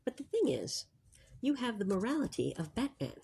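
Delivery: hushed library voice, barely above a breath; library whisper. Real, not acted. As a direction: keep it soft so nobody in the library is disturbed. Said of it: "we always use our library voices in the library".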